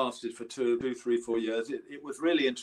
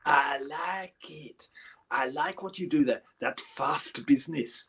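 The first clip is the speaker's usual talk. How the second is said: [name]; 3 semitones higher, 6 dB louder than usual. Retold or imitated. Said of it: "turned round to me finally and said".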